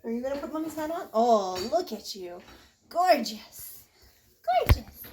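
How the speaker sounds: high, sing-song praise; doting parent voice. Real, not acted. Cooing praise at small child trying hat.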